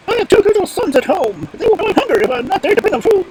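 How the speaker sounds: high, loud squeaky bird plea; very high-pitched imploring stork; pleading stork. Acted. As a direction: beg for your young in a piping bird voice.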